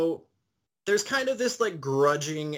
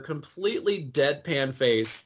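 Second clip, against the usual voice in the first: ordinary talk, nothing marked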